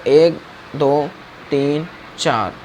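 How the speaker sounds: slow, spaced-out counting. Shown as a demonstration. Teacher counting along to the slowed animation, slow and spaced. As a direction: count slowly, one number per animation step.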